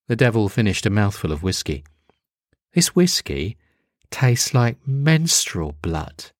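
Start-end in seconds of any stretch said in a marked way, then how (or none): none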